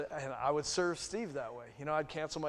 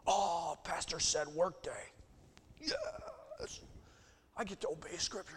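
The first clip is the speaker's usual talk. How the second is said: high, sing-song parody of a keen believer; hammed-up pious volunteer voice; mock eager churchgoer; 5 semitones higher, slower than usual. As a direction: send up the dutiful churchgoer, slow and bright.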